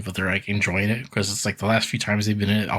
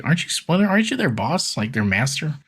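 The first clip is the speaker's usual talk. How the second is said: high, incredulous teasing questions; mock disbelief; 6 semitones higher than usual. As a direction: poke fun at them, voice pitched up. Mocking the turtles' obedience.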